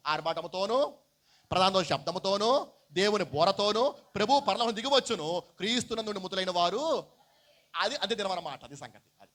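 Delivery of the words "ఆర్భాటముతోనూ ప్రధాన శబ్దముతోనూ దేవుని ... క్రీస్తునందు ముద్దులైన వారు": scripture reading. Acted. Preacher reading of the Lord's descent, loud scripture reading. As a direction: declaim each phrase loudly, drawing it out. Reads out the verse.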